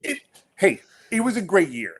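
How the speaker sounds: high, tripping restarts, caught off guard; flustered stammer. Real, not acted. Asked about his award, flustered and stammering. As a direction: stumble into it, embarrassed by the praise. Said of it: "you're super uncomfortable at talking about".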